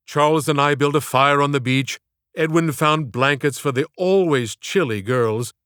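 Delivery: ordinary delivery, nothing marked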